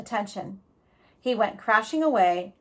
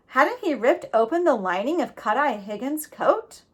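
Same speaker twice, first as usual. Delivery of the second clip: ordinary delivery, nothing marked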